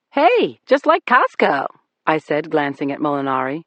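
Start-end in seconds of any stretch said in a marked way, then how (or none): 0.1-1.7 high, bright mock delight at Costco; sarcastic chirp, much higher; mock cheerful. A narrator's reading. Cop mocks the bartender's private club, sarcastic.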